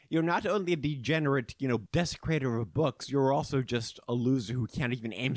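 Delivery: loud, high taunting princess; haughty fairy princess jeering; mocking princess. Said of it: "the Flower Princess laughs at you and says".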